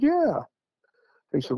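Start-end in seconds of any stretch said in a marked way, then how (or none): 0.0-0.4 a sudden high, loud "Yeah"; eager yes. Retold or imitated. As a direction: blurt it out, eager and surprised. New neighbour admits owning old place.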